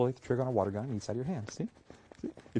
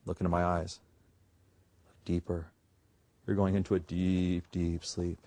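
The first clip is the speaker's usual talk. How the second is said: mock hypnotist; 4 semitones lower, slower than usual. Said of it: "I've hypnotized the raccoon".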